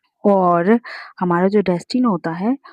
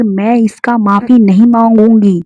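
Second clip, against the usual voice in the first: ordinary delivery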